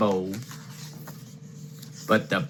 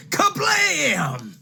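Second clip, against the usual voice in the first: crash sound word. A narrator's reading. Chair crashes to pieces.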